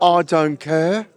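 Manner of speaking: put-on slow, drawn-out Brummie accent that slips toward Australian; mock Brummie accent. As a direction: stretch out "I don't care" in a half-Australian Brummie accent. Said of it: "That sounds a little bit Australian".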